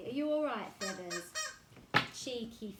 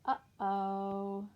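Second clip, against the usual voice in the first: put-on reading to children; 4 dB louder, slower than usual. A narrator's reading. Reads picture book aloud.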